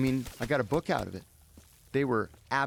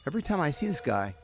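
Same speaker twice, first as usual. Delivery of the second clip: ordinary delivery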